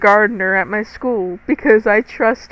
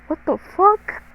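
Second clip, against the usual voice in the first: high-pitched retold disbelief; incredulous high-pitched question; incredulous reaction; 8 semitones higher than usual. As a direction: replay your disbelief, pitched way up. Retelling her shock at the pictures.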